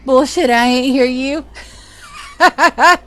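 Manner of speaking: usual speaking voice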